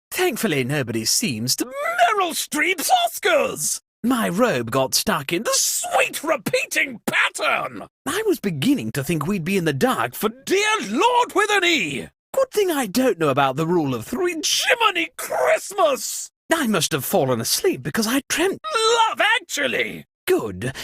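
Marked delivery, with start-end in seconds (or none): 1.6-3.8 high, shocked shout of a made-up oath; startled cry. Acted. Host startled by the blackout, high shocked shout. 14.4-16.3 high, shocked shout of a made-up oath; startled cry. Acted. Host startled by the blackout, high shocked shout.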